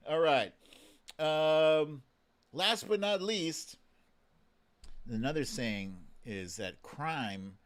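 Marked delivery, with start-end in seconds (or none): none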